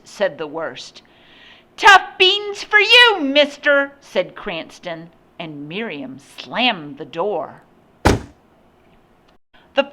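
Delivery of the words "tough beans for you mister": put-on high, loud child's jeer; bratty taunt. A narrator's reading. Boy before slamming the door, bratty and loud.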